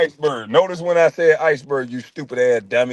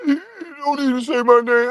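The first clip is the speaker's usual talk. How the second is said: high, tearful mocking whine; hammed-up weepy falsetto; mock crying; 10 semitones higher than usual. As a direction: imitate a man crying over a slight. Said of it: "He cried too much".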